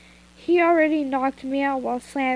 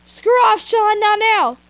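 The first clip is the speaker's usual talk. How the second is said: much louder, higher yell to someone off-mic; annoyed shout; 9 semitones higher, 9 dB louder than usual. Real, not acted. Reader yelling at Sean to stop interrupting, annoyed. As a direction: shoo him away loudly.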